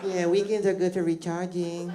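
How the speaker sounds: high [name] voice at the office; [name] impression. Michael answers coworkers.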